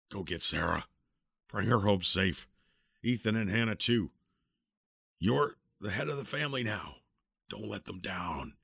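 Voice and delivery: strained, fading dying command; dying plea. Acted. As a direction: charge him with your last strength. Dying uncle's last charge.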